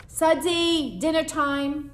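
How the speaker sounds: high, loud call to dinner; mother calling. Acted. Mother calling her son to dinner, high and loud. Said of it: "My mother's voice".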